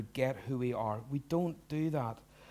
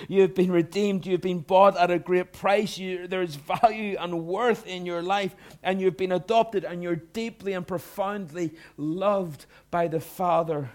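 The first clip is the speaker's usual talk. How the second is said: preaching at full force; 5 semitones higher, 7 dB louder than usual. Real, not acted. Pastor thundering assurance at the congregation, full preaching voice. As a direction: proclaim it, building to a roar. Preaching climax, rising volume.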